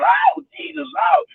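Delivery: high, hammed-up church cry; mock religious hollering. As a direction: squeal it in mock devotion. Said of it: "It was all over religion".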